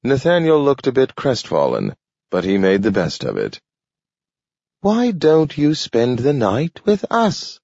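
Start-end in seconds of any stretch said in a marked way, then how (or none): 4.8-7.6 high, slow, friendly invitation; a dog inviting the man home; inviting talking dog. A narrator's reading. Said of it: "he invited".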